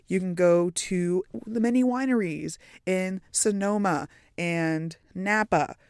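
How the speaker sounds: usual speaking voice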